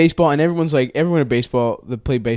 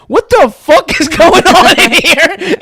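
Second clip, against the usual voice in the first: much higher, louder, slow outraged yell; coach's shout; 9 dB louder, slower than usual. Retold or imitated. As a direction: bellow like a furious coach. Coach bursts in yelling.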